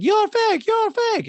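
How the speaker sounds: piercing, loud falsetto accusation; hammed-up mock shriek, very high; Diana impression. Diana accuses Sutton, in a loud mock falsetto shriek. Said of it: "And Diana's like".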